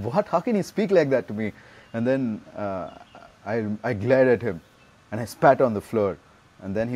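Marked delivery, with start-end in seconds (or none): 0.0-1.5 higher-pitched, mildly indignant retort; lightly acted indignation, pitched up; mock outrage. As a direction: imitate someone offended and mad. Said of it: "really mad at me".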